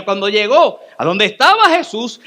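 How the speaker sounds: ordinary delivery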